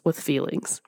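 whisper. Real, not acted. Dramatic closing point.

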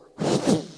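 loud unvoiced blown whoosh; imitated gust as a door flies open; whooshing door sound. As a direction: blow a burst of air for a swinging door.

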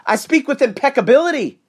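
very high, emphatic proclamation; high-energy declamation. Real, not acted. Host answering people who question his speech, emphatic high-energy declaration. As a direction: proclaim it proudly at full energy. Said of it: "Do you speak like this all the time".

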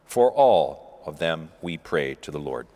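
steady liturgical reading, each petition falling to its close; intercession prayer. Acted. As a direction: lead the congregation's petitions calmly. Leads prayers of the faithful.

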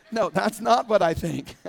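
real laugh breaking through. Real, not acted. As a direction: chuckle as you correct yourself. Laughing at his own joke.

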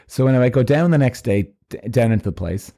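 ordinary talk

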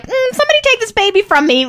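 high, loud, retold cry for help; overwhelmed plea. Mother being stitched while holding baby, exasperated plea. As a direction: act out being at the end of your rope.

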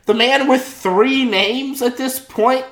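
high, hammed-up incredulity; mock disbelief. Listener hears the upstanding man lied, mock disbelief. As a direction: feign outrage, pitching up into the questions.

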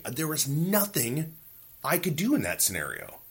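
normal talking voice

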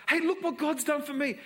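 high, loud, thrilled announcement; excited daughter. Acted. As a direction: burst in with the good news. Daughter voiced in story.

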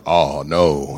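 low, loud mock groan of dismay; manly deep voice. Injured lifter revising his scream for the audience, mock deep voice. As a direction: drop it deep to cover for a shriek. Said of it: "But you hear my voice. It was more like".

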